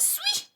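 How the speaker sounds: very high, quiet zipping whistle of a noise; whoosh. Retold or imitated. Woman flees just like that, imitated whoosh. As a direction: imitate someone zooming off.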